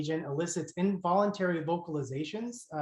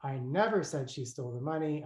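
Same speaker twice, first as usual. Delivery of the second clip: "never" lifted and pressed harder than the rest of the line; stress on NEVER. Shown as a demonstration. Lecturer demonstrating a pitch accent to students, stressing never. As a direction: lean on "never" to show where the accent falls. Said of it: "spoke sentences with pitch accents on different words".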